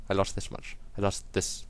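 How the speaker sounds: ordinary talk, nothing marked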